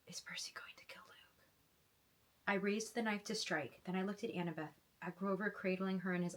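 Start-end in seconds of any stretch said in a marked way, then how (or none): 0.1-1.5 breathless whisper. Real, not acted. Reader whispering in suspense over the knife. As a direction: whisper it like you can't look. Reading tense moment aloud.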